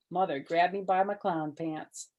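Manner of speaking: ordinary delivery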